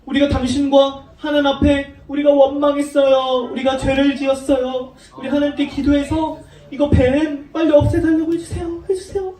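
urgent mock plea, rising on the last request; remorseful pleading. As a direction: imitate a crowd pleading for forgiveness. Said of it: "이렇게 막 잘못했다고 그랬어요".

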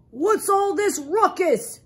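put-on loud, slow old grandfather voice; old grandfather groundhog. A narrator's reading. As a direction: play an old groundhog demanding an answer. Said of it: "asked old Grandfather Groundhog".

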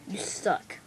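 hushed whispered jab. Acted. To his farting friend, a whisper. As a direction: whisper the insult.

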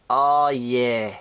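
ordinary delivery

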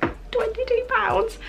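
high, drawn-out gasp at the price; twenty-two pounds said with fake horror; mock price shock. Real, not acted. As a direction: squeak the price out in disbelief. Reacting to the price.

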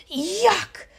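high squawked yuck; disgusted seagulls. A narrator's reading. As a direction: spit it out like a gull tasting mustard. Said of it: "uttered the seagulls".

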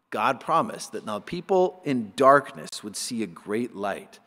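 ordinary delivery

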